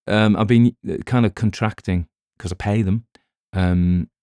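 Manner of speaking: normal talking voice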